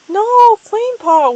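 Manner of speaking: high, loud wailing cry. A narrator's reading. Lakepaw watching her sister near death, wailing. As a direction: wail as your sister is about to die. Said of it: "wailed Lakepaw".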